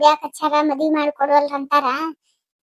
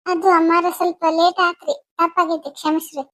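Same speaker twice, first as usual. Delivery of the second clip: ordinary talk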